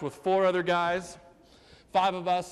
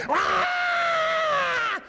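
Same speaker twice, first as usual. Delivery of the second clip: high, loud screeching cat shout; hammed-up cat screeching at the door; 4 dB louder, much slower than usual. The cat clings to the screen door, screeching loud and high. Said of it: "attach herself to the screen going".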